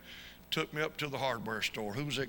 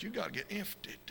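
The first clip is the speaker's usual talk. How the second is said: a lightly voiced higher take on the old preacher; "infted" said in a soft, voiced, slightly higher mimic; imitating Brother Fane; 8 semitones higher, 4 dB quieter than usual. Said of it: "I can hear him say it now".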